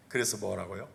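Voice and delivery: ordinary talk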